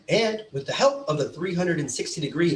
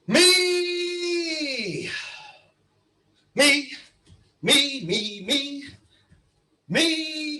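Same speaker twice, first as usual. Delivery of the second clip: shouting; 8 semitones higher, much slower than usual. Acted. Speech opener shouting me repeatedly.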